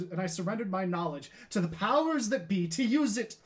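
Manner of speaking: Galileo, confessing. Acted. Galileo admitting he surrendered his knowledge, building to a declaimed outburst. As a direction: build the confession into theatrical self-accusation.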